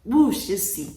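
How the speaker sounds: plain reading voice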